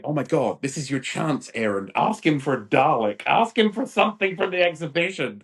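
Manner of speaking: bursting to tell little brother, pitch climbing; retold excited urging. Teenager silently willing his little brother to ask for a prize, excited urging. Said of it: "My head is gonna explode".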